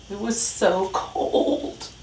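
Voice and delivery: slow, drawn-out narration; shivery emphasis. A narrator's reading. Reader describes the freezing morning, slow and drawn out. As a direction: stretch out the cold. Narrator dramatizes the winter cold.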